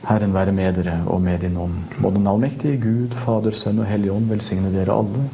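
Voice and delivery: solemn blessing spoken over the listeners; Norwegian blessing. Real, not acted. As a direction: give the blessing like a priest at the altar. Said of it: "I then do it in my native language".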